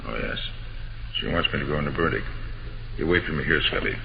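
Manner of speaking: low, soft hospital murmur; hushed. Acted. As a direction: speak softly outside a patient's room.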